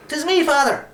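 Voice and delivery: put-on eager small boy answering; boy's voice. A narrator's reading. Boy answers the priest from above, anxious. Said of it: "I said, for fear he mightn't see me".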